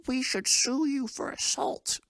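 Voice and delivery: very high, slow mock screech; background mom impression. Mom threatening the puncher from the background, high mock indignation. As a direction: caricature an indignant mother from another room.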